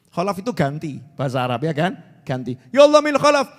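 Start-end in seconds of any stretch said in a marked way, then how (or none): 2.8-3.5 loud, high pleading cry to Allah; crying out to God. Retold or imitated. A scholar crying out to Allah so loudly the whole village runs out. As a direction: call out to God loud enough to wake the village. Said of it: "Sampai teriak".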